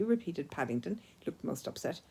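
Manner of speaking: usual speaking voice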